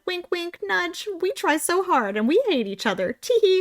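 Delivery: high, simpering fake innocence; coy mock answer. Mocking a hockey player's canned media answer, coy and high. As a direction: sell it like a coy, giggly press line. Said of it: "is meant to be like".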